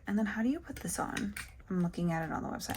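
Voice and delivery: whispered, fast, to herself; whispering. Real, not acted. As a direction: whisper it to yourself. Whispered muttering to self.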